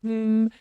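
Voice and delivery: high, held hum; humming along. Shown as a demonstration. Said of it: "just hum along".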